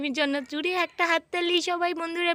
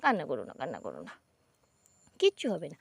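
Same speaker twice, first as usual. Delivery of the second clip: soothing mother; 10 semitones lower, faster than usual. Real, not acted. Mother soothing her crying daughter, low and gentle.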